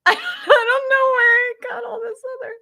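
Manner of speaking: high, loud, laughter squeezing the words; helpless amusement. Real, not acted. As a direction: squeal it out mid laughing fit. Still in the laughing fit.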